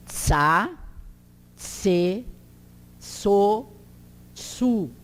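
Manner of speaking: "tsa, tse, so, tsu" separated, each with a ts onset; TS syllable drill. Shown as a demonstration. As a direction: recap "tsa, tse, so, tsu" with pauses, "so" as tso. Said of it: "tem o som de tse".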